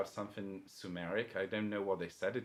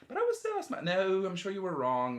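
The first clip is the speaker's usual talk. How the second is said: insistent denial; 4 semitones higher, 6 dB louder than usual. Retold or imitated. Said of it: "and then I said".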